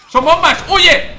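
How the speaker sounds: high, loud warning yell; sudden high-pitched cry of alarm; alarmed shout. Real, not acted. Warning of bombs in game.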